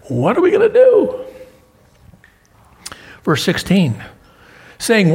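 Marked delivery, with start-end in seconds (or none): 0.1-1.3 high, loud, frantic cry; the elders throwing up their hands in alarm; panicked question. Acted. Council fretting in the back room, high and panicked.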